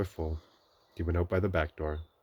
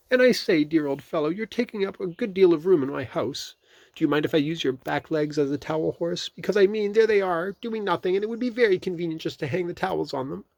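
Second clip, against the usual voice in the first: high, louder busy Rabbit voice; 12 semitones higher, 8 dB louder than usual. A narrator's reading. Rabbit to the wedged bear in his house, bustling. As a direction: chatter on brightly as fussy Rabbit.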